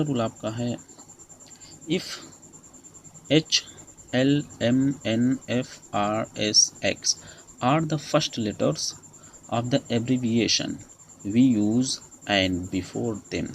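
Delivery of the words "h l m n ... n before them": very slow, each word set apart; slow rule dictation. Shown as a demonstration. Teacher reading a grammar rule to students, very slowly. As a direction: read the rule out slowly for notes.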